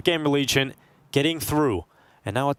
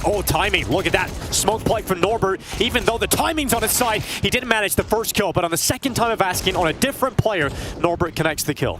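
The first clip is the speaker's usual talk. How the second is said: excited call; 6 semitones higher than usual. Real, not acted. Caster calling a sudden kill.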